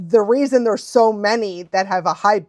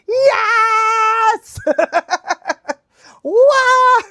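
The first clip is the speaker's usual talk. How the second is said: high, loud whoop breaking into laughter; thrilled cheer; 12 semitones higher, 4 dB louder than usual. Real, not acted. Fan cheering for a beloved show, excited shout.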